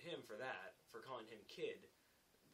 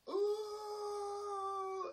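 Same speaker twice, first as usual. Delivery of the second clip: long, high, loud hoot; jeering "ooh"; 12 dB louder than usual. Retold or imitated. Apprentice reacting to the laborer's taunt, jeering hoot. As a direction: hoot like someone egging on a fight.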